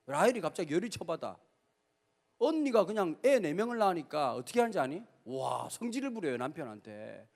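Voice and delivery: ordinary talk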